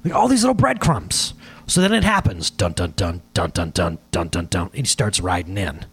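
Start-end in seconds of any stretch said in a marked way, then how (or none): 2.5-4.7 dramatic dun dun dun. Pretend. Dramatic build-up to Jesus' entry.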